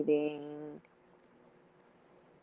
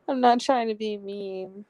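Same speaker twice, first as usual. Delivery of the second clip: speaking through a real laugh; giggly apology; 3 semitones higher, 4 dB louder than usual. Real, not acted. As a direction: excuse yourself while still giggling. Giggling while excusing herself.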